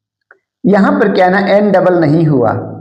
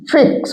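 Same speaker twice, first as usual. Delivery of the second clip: Fix higher, pressed on its first syllable; stressed first syllable; 6 semitones higher than usual. Shown as a demonstration. Teacher demonstrating pressure on a syllable, stressed start of fix. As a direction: lean into the opening of fix. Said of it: "बोलते हैं deep sound में".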